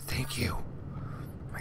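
quick hushed gratitude; relieved whisper. Real, not acted. Gamer finally finds an ocean planet, relieved whisper. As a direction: whisper it fast, half to yourself.

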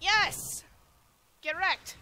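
loud, high cry of success; excited gamer victory shout; triumphant. Real, not acted. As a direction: cheer as your move finally works. Move works in game.

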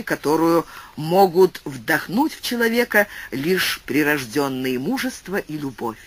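ordinary delivery, nothing marked

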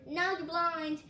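ordinary delivery

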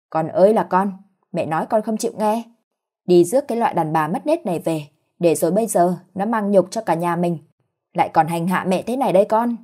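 ordinary delivery, nothing marked

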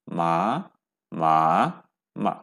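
মা through all four Chinese tones in turn; four Mandarin tones. Shown as a demonstration. Teacher recites the four tones of মা in order. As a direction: run মা through level, rising, dipping, falling. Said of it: "এভাবে পড়তে হবে".

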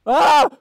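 high, loud burst of a scream. Real, not acted. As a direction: scream suddenly, high and short. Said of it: "Stop screaming!".

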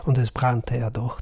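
ordinary delivery, nothing marked

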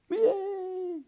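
very high, drawn-out hooray; child's cheer. Acted. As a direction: cheer like a delighted little boy. Hal cheers the plan.

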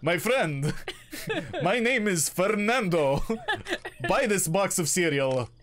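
put-on Spanish-accented TV ad salesman hawking cereal; Spanish cereal pitchman. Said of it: "you sound like a fucking guy trying to sell me cereal".

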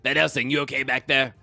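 high, laughing character call; high muffled character voice; Ginger's voice. Acted. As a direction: call back over the wagon in character. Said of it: "it sounds like".

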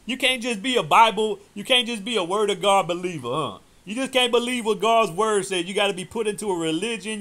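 loud, high fired-up preaching; preaching at full force. Acted. Preacher scolding rival doctrines, full-force preaching. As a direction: thunder it at the congregation like a revival preacher.

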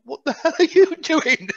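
high, loud, incredulous mock question; laughing disbelief. Soldier reacting to the Cockney Iraqi, in amused disbelief. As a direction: act astonished, with a laugh breaking in. Acted astonished reaction to the prisoner.

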